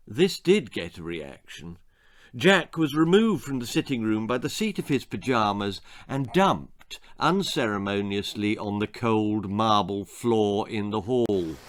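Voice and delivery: ordinary delivery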